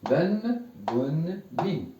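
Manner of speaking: teacher drilling the nunation; modeling bin. Shown as a demonstration. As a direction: repeat "بن" for the class. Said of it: "ونقول".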